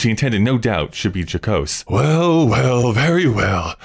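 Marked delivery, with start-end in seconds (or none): 1.9-3.8 drawn-out, higher mock-jolly voice; strange forced jocularity. A narrator's reading. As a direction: stretch the words with uneasy, menacing humour. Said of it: "in a strange, shrieking sort of manner".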